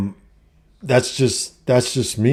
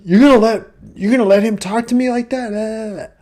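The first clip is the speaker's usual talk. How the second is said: put-on much higher, indignant girlfriend voice; hammed-up shrill girlfriend, much higher and louder; mock outraged girlfriend; 13 semitones higher, 5 dB louder than usual. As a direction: play her demanding he take her side. Girlfriend confronts buddy, voiced.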